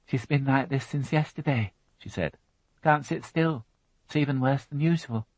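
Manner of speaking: sulky daughter; teenage girl voice. Acted. Alison's voice.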